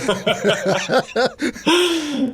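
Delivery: high-pitched burst of laughter; real laugh. Real, not acted. Band member after mocking his own pretension, high amused laugh. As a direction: laugh out loud, high and sustained.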